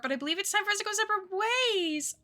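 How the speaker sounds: high, rushed, whiny dismay; mock wail. Player reading the breakup letter aloud, high dismayed wail. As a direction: race upward into a hammed-up wail.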